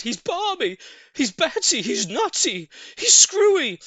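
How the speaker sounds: high, loud mocking chorus; animals crying out. A narrator's reading. Animals mocking Mugglewump, high squealing. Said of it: "they cried".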